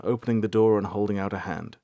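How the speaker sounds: plain reading voice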